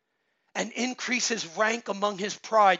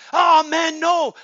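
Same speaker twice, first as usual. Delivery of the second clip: scornful outburst; 6 semitones higher, 9 dB louder, slower than usual. Acted. Preacher reacts to soft advice.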